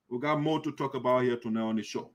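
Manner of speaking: ordinary talk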